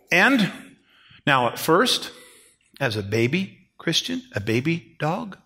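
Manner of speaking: usual speaking voice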